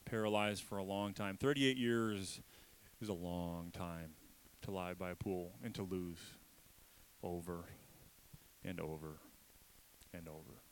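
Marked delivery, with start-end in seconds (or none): 3.0-10.6 fading to hush. Real, not acted. Preacher fading to a hush over the lost years. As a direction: let each over sink quieter. Sermon on long suffering.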